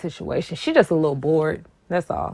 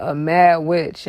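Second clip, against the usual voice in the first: ordinary delivery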